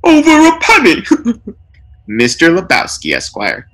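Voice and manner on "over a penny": higher, louder punchline delivery; gleeful joke-telling burglar. A narrator's reading. Burglar mocks the taped-up lawyers with jokes, louder. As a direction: land the punchline like a heckler.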